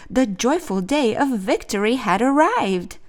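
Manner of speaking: plain reading voice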